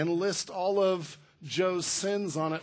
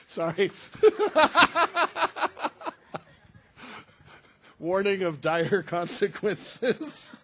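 laughing; 6 dB louder, slower than usual. Real, not acted. Laughing at his own slip.

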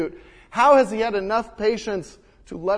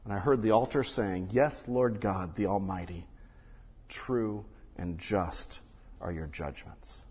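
hushed reverent reading; 11 semitones lower, 10 dB quieter than usual. Acted. Pastor reading the altar's answer in a hushed, reverent voice. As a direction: let the verse fall to a hush.

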